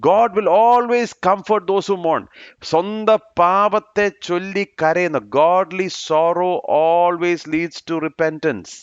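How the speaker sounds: slow, high, deliberate English sermon lines; measured preaching cadence; emphatic preaching. Acted. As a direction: lean on each phrase like a pulpit preacher. Preacher proclaims key truth.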